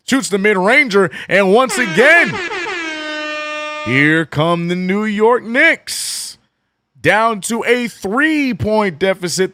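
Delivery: high, loud broadcast-style call; live play-by-play. Acted. Streamer calling the Knicks comeback for chat, high and loud. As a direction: narrate each possession like a TV announcer.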